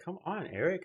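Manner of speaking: mimicked partner. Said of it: "Leah looks at me like".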